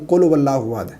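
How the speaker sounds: هو started with a w sound, no ہا; wrong pronunciation. Shown as a demonstration. Teacher imitates students starting هو with waw. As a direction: rush قل هو so it sounds like "qul wo". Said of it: "لیکن اس کو غلط پڑھتے ہیں".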